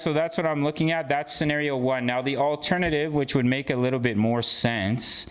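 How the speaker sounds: usual speaking voice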